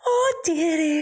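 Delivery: put-on high, slow old nanny voice through laughter; hammed-up Mrs Doubtfire. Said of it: "your Missus Doubtfire".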